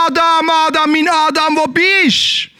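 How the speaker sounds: high, longing call; God calling. Acted. God searching for Adam in the garden, calling high. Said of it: "Und Gott hat gerufen".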